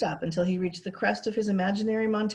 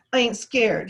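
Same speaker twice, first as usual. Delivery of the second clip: stonily; 5 semitones higher, 6 dB louder, slower than usual. A narrator's reading. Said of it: "he said stonily".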